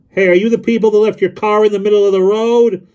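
loud high-pitched holler; calling out. A narrator's reading. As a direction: yell down from a rumbling truck cab. Driver calls from truck cab.

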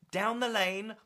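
plain reading voice

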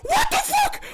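high, loud stunned shout; shocked shout. Real, not acted. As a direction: yell it in total disbelief. Shocked reaction to lucky play.

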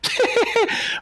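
very high, airy giggle; gloating giggle. Real, not acted. Player gloating after the knockout, high giggle. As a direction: giggle high over the victory.

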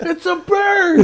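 very high, drawn-out holler; hammed-up Superman onlooker yell; excited shout. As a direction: shout it like an astonished bystander. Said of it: "This is to shout that out first".

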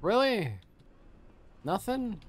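high, falling disbelief; incredulous. Real, not acted. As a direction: genuinely let down by the loot. Disappointed at empty container.

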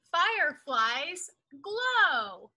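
usual speaking voice

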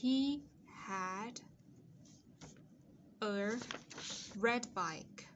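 "He had", long pause, "a", pause, "red bike"; spaced-out reading, "a" standing separately from "had"; slow dictation. Shown as a demonstration. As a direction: dictate in pieces with time to write. Dictating the sentence word by word.

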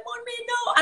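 high-pitched mock plea to mum; pleading child. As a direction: imitate a child begging mother to stop. Retold child pleading with mother.

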